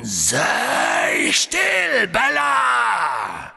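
high, loud, drawn-out roar; threatening. A narrator's reading. Dark lord silences his grovelling servant, threatening shout. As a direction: silence her with a long menacing shout. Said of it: "sagte Voldemort drohend".

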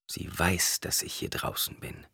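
soft, private musing; inner thought. A narrator's reading. Paul wonders about his sister, thinking to himself. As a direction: think it aloud, very softly. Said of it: "dachte Paul".

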